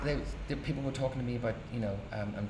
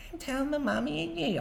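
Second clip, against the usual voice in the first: much higher squeaky parody of a tattletale; mocking mimic. Older brother mimicking the tattling boy, squeaky mockery. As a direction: mimic the little brother to mock him. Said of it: "our Paddy mimics me".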